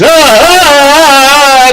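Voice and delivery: long high mock "aah"; drawn-out hammed-up panic cry; imitated scream. Imitating a guest reacting to a shoulder tap, long high scream.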